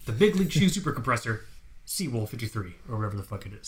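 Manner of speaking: ordinary delivery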